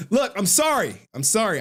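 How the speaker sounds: protesting sorry; emphatic apology. Real, not acted. Apologizing to viewers over camera.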